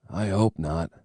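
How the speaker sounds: soft, husky and weary; husky reply. A narrator's reading. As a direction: husk it out, drained and ill. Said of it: "I husked in return".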